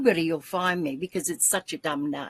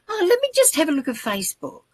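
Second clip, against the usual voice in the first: breezy casual thought; 9 semitones higher, faster than usual. Retold or imitated. Retells her casual thought in the car.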